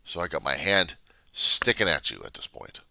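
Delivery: ordinary talk, nothing marked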